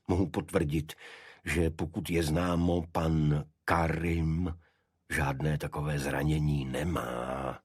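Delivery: ordinary delivery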